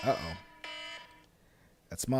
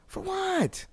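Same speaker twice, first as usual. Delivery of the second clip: incredulous; 6 dB quieter than usual. Real, not acted. Rhetorical challenge to listeners.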